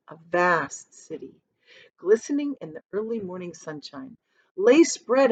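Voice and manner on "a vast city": usual speaking voice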